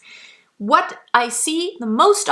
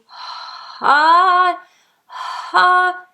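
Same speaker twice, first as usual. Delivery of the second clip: breathy onset; 7 semitones higher, 4 dB louder than usual. Shown as a demonstration. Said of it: "That would mean".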